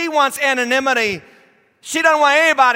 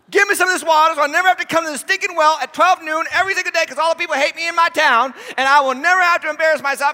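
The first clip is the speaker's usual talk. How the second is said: eager; 5 semitones higher, faster than usual. Acted. Samaritan woman grabbing at the offer of water, eager and fast. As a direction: gush like she's already sold.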